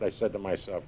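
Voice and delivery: ordinary talk, nothing marked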